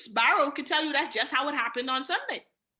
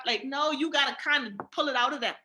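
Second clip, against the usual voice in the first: ordinary talk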